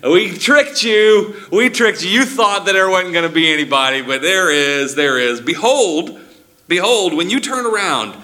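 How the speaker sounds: high, loud, teasing announcement; playful triumphant reveal. Acted. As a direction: gleefully let him in on the trick. Elder revealing the Lion.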